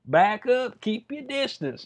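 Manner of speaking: half-joking alarm, keeping someone at arm's length; mock nervous warning. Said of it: "You're like".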